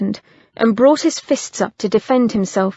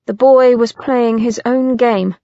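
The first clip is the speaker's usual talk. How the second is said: plain reading voice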